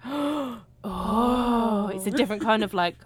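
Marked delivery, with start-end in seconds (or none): 0.0-1.9 sharp gasp into a louder, higher "oh"; mock dawning shock; gasping realisation. Viewer acting out a second watch, gasping realisation. Acts out reaction on rewatching.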